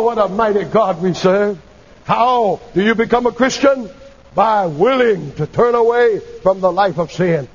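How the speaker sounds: ordinary delivery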